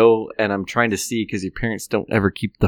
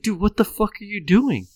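high incredulous what the fuck; exasperated disbelief; 10 semitones higher, 5 dB quieter than usual. Retold or imitated. Catching the drunk friend in the green belt, high incredulous. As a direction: grab your drunk friend and demand answers. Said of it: "I was like".

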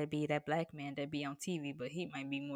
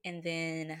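usual speaking voice

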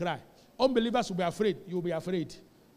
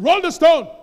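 loud command, much higher; commanding shout; 8 semitones higher, 15 dB louder than usual. Retold or imitated. Preacher as Jesus ordering the stone rolled away, loud. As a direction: call it out with full authority.

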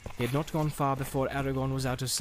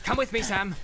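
urgent, higher-pitched appeal; pressing, raised voice; Aragorn; 9 semitones higher than usual. A narrator's reading. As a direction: urge a companion to come along. Aragorn urging Sam along.

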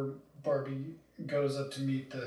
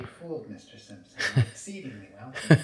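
lightly voiced rich villain, a touch of Bill Gates; Lib Hitler impression; 5 dB louder than usual. Teasing friend with a light smug-billionaire impression. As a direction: play a smug tycoon, half joking. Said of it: "that was a good impression you just did".

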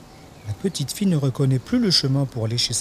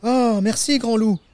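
put-on high, grateful little-girl exclamation; high opening, dropping back toward normal; exclaiming little girl; 11 semitones higher, 3 dB louder than usual. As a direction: burst out with thanks like a child. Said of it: "s'exclame la petite fille".

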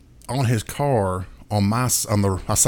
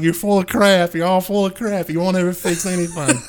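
high mock fed-up scolding; fed-up friend; 8 semitones higher than usual. Friend calling him full of crap, high mock scolding. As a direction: scold with hammed-up disgust. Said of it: "he's like".

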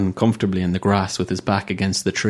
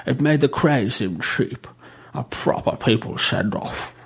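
put-on fussy old bishop; 3 semitones higher than usual. A narrator's reading. Bishop character voice.

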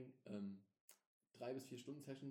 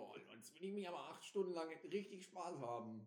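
mock eager gamer; 6 semitones higher, 3 dB louder than usual. Mimicking eager players.

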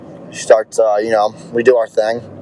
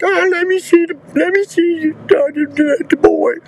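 mimicking the mom. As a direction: squeak it out like a frail bedridden mother. Imitates the bedridden mother.

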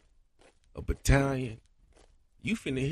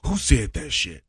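ad lib shout; 10 dB louder than usual. Retold or imitated. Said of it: "they say in the background".

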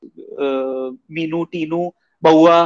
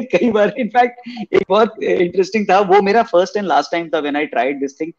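ordinary talk

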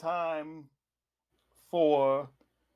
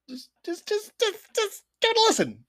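much higher, eager pleading; 14 semitones higher, faster than usual. Acted. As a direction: beg them to just listen. Begging listeners to try episode.

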